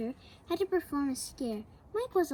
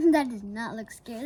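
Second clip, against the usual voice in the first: plain reading voice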